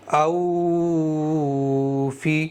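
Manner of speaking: "او فی" with the واو dragged out very long and slow; deliberately stretched. Shown as a demonstration. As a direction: draw "او فی" out long to show the mistake. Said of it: "کھینچ کر کے بھی پڑھ کے سنا دیتا ہوں".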